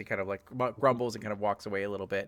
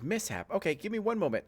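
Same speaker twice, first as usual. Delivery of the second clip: receptionist; 9 semitones higher than usual. Acted. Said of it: "And she goes".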